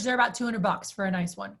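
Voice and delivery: ordinary talk, nothing marked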